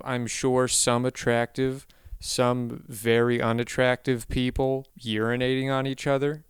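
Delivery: ordinary talk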